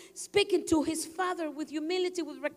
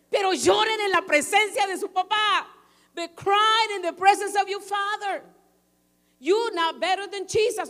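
loud exhortation; 6 semitones higher, 8 dB louder than usual. Real, not acted. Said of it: "exhorting the men".

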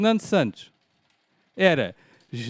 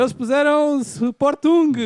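high, loud and slow; excited mock; 10 semitones higher, 6 dB louder, slower than usual. Real, not acted. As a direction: crow over their answer with glee. Mocking rivals' wrong answer.

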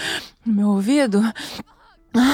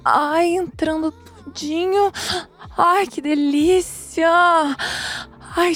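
higher-pitched, moaning narration; acted pleasure; 6 semitones higher, slower than usual. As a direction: draw words out with rising pleasure. Narrator acts out mounting pleasure.

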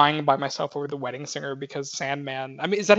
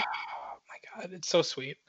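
sighing, breathless, slow and soft; awed whisper; 11 dB quieter, slower than usual. Real, not acted. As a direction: let it out on a sigh, touched. Moved by a sweet film scene.

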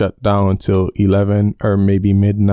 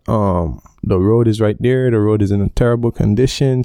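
usual speaking voice

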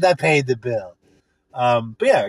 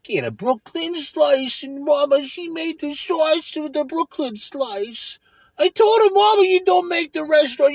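mock Brooklyn pizzeria guy; 9 semitones higher than usual. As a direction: ham up a Brooklyn pizza owner.

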